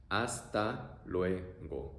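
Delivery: very slow Hasta luego. drawn out; teacher modelling slowly; slow demo. Shown as a demonstration.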